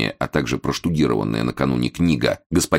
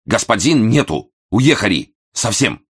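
put-on clipped, Japanese accent; 6 semitones higher, 4 dB louder than usual. A narrator's reading. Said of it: "отчеканил".

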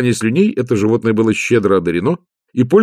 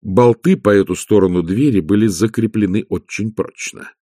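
plain reading voice

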